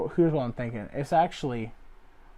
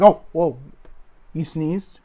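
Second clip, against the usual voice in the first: mock alarm; 4 semitones higher, 9 dB louder, slower than usual. Nurse reacts to a sneeze.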